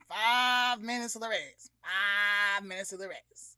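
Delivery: loud PA announcer voice; announcer call. Retold or imitated. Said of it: "the announcer guy is on the, the system like".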